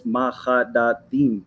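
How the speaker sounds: "mahadadim" slowly spaced out as ma-kha-da-deem; mispronounced Hebrew word. Real, not acted. Man reading an AI answer aloud, "mahadadim" slowly sounded out. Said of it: "you're pronouncing it wrong".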